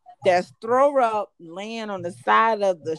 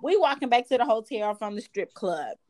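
ordinary talk, nothing marked

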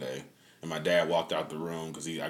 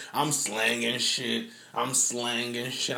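slow emphatic; 5 semitones higher, slower than usual. Real, not acted. Frantic searching for laptop.

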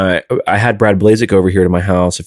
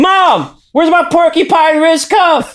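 put-on high, whiny basement-kid shout; yelling across the room; slower than usual. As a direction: yell upstairs for mom like a man-child. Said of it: "forty-five-year-old thrash kids ... in their mom's basement".

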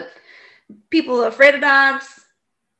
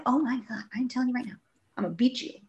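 muttered threat; 2 semitones higher, 8 dB quieter, faster than usual. Retold or imitated. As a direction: grumble a threat under your breath. Retold threat, under the breath.

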